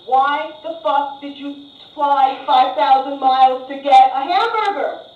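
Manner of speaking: slow, loud disbelief; exasperated rant. Acted. Comic berating tourists who want hamburgers, loud exasperated disbelief. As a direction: pound it out, word by word.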